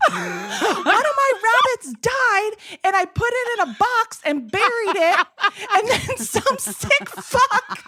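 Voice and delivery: high, slow, half-laughing wail; hammed-up shrill wail, laughing; outraged farm wife. Wife's outraged high wailing quote.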